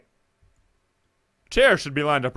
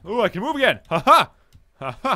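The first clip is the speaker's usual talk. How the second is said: excited relief; 4 semitones higher, 7 dB louder than usual. Real, not acted. Relief at moving again.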